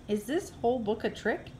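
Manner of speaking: usual speaking voice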